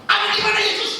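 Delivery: high, loud pointed question. Retold or imitated. Pastor confronting a churchgoer, loud demanding question. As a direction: press him hard on where Jesus is.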